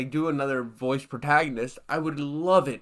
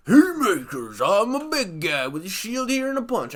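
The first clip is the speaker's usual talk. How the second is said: a hint of a boastful tank guy, higher; lightly voiced brute; 5 semitones higher, 4 dB louder than usual. Mocking the punching tank guy, a hint of boastful brawler. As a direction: puff up like a dumb brawler.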